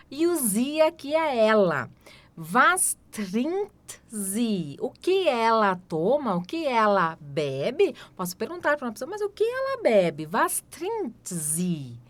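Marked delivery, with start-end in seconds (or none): 2.4-4.8 "Was trinkt sie?" drawn out very slowly; very slow demo. Shown as a demonstration. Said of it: "teacher models question". 10.3-12.0 "Was trinkt sie?" drawn out very slowly; very slow demo. Shown as a demonstration. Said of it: "teacher models question".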